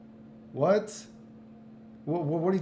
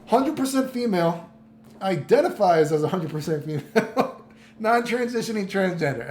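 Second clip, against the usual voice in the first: repeating her words through laughter, higher; laughing quote; 4 semitones higher, 4 dB louder than usual. Retold or imitated. Streamer laughing while repeating a girl's claim to viewers. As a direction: quote it back while cracking up. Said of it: "I was like".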